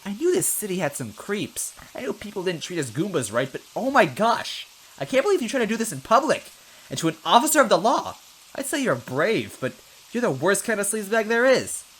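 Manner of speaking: high, loud outraged rant; indignant officer. Acted. Goomba officer berating Luigi for stomping, outraged high rant. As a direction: scold the creep at full pitch.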